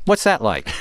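normal talking voice

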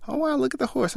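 imitating the fiancée's delight at the horse. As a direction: gush about the horse. Fiancée sees the carriage.

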